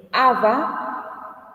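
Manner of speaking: aba with the first A stressed and drawn out; slow AH-ba, weight up front; stress on first syllable. Shown as a demonstration. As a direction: lean on the opening A of aba. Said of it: "tiene acento".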